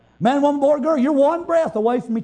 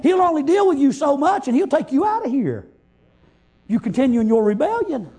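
ordinary delivery

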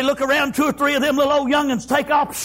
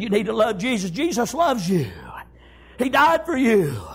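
tender mother; 3 semitones lower than usual. Acted. Mama telling little kid about Jesus, tenderly. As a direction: lower the voice and speak tenderly. Mother gently teaching her child.

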